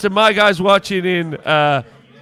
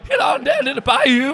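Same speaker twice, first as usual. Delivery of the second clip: higher, hammed-up Southern American accent; mock Southern accent; 7 semitones higher than usual. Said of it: "my accents on the street".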